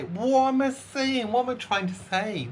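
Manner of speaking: real flustered, high-pitched bafflement. Real, not acted. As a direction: wail at yourself for losing the line. Flustered after fluffing her intro.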